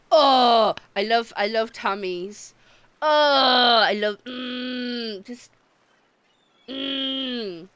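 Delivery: loud, drawn-out Oh, I love; swooning delight. Real, not acted. Scene: streamer gushing about a big-bellied monster, loud swoon.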